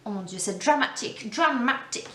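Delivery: mock dramatic gushing. Mock gushing over product name.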